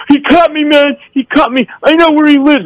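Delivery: high-pitched imitated screaming; mock drunken scream. Cut man at the bar accusing his attacker, mock screaming. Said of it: "and he's screaming".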